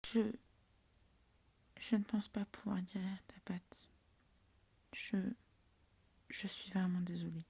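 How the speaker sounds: hushed sorrowful healer; sad whisper. Acted. Healer gives bad news.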